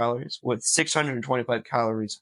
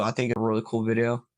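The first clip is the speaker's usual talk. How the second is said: ordinary talk, nothing marked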